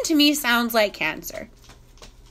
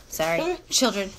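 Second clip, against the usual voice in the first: ordinary talk, nothing marked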